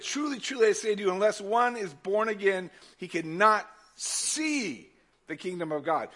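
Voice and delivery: high then slowing proclamation; solemn scripture reading. Acted. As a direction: read the verse like a proclamation.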